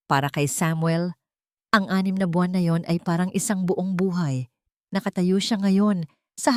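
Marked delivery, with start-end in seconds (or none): none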